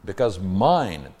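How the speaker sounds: MINE drawn out slow and loud; stress on MINE. Acted. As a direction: lean long and hard on "mine". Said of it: "emphatic pronoun".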